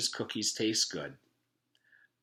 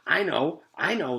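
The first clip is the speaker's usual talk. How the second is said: put-on loud, high eager kid voice; desperate to be called on; 8 semitones higher, 8 dB louder than usual. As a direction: kid bursting to answer. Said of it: "Joe waved his arm back and forth".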